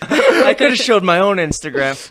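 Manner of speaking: laughing. Real, not acted. Laughing while talking.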